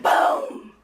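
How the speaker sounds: high, booming storyteller burst; dramatic thunder boom. Retold or imitated. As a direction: crash out like thunder. Imitating thunder crash.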